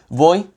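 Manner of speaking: "Voi?" with a questioning rise at the end; rising question. Shown as a demonstration. Offering water to a listener, asking "Voi?" with a rise. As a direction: ask "Voi?" with the tune going up. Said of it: "my voice intonation goes up at the end".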